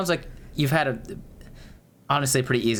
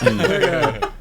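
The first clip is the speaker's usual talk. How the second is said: laughing; 5 dB louder, slower than usual. Real, not acted. Laughing while cutting the topic off.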